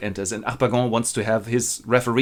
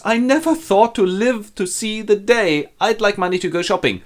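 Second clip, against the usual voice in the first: hammed-up cook; 10 semitones higher than usual. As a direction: marvel grandly, then ask for cash. Cook reacts to dinner order.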